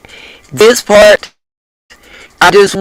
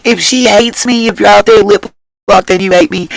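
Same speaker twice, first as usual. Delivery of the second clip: ordinary talk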